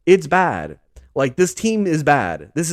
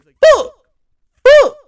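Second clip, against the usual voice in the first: screamed frustrated curse, very high and loud; furious yell; 12 dB louder than usual. Retold or imitated. Imitating Kyler storming off the field, furious yell. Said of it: "it was a pretty good impression".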